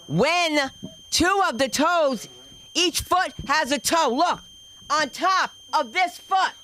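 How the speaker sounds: high, slow, emphatic disbelief; mock horror. Comic describing extra toes to audience, high slow mock horror. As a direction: draw out each phrase high, aghast.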